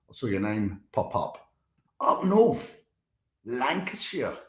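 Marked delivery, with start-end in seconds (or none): 2.0-4.4 put-on tentative northern English, Lancashire accent; attempted Lancashire accent. Streamer greeting a viewer from up north, attempted Lancashire accent. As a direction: have a rough go at a Lancashire accent. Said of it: "what's the Lancashire accent?".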